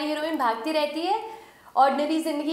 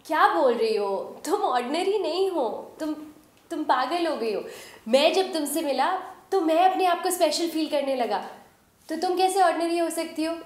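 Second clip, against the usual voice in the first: higher, animated objection; insistent protest; 4 semitones higher than usual. Acted. Companion refuses to accept the heroine is ordinary, higher and insistent. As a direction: argue back with swooping, raised pitch.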